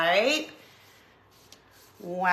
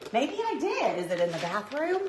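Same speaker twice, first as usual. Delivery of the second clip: ordinary talk, nothing marked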